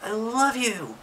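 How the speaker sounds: high-pitched happy declaration; exclaimed. Shown as a demonstration. Showing a child an exclamation mark, exclaimed. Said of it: "I'm exclaiming something".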